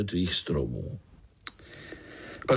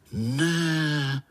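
higher, drawn-out no of disbelief; astonished; 5 semitones higher than usual. A narrator's reading. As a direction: marvel on a single stretched word. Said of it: "podivili se pavoučci sborem". (udivené, protáhlé zvolání)

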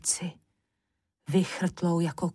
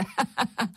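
brief, higher mocking laugh; witch cackle; 4 semitones higher than usual. A narrator's reading. Witch taunting petrified Mikeš, brief cackle. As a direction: cackle with spiteful glee.

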